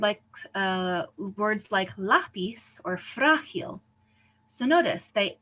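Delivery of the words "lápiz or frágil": "lápiz or frágil" stressed on LÁ and FRÁ; stress on second-to-last syllable. Shown as a demonstration. Teacher gives Spanish examples, "lápiz or frágil" stressed on the first syllables. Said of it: "stresses the second to last vowel".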